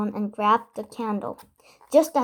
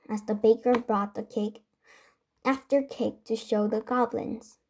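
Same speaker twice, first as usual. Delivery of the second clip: ordinary delivery, nothing marked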